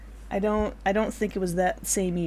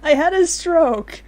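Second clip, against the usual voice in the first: laughing; 6 semitones higher, 6 dB louder than usual. Real, not acted. Laughing, loud.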